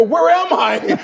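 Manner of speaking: very high, loud mock shout; bewildered cry. Dazed traveller asking shop woman where he is, bewildered shout. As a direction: call out lost and confused, half laughing.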